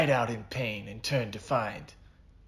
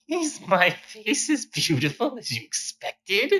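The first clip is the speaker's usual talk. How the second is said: high, mocking villain voice; 11 semitones higher, 4 dB louder than usual. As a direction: tease him with a sly high voice. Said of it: "Silent said".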